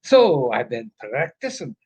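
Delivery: ordinary delivery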